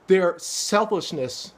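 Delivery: ordinary delivery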